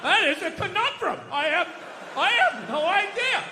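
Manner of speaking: faltering, hesitant mock bafflement; feigned clueless stammer; mock dumbfounded. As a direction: play a sports host pretending not to know. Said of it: "sit there, like, dumbfounded".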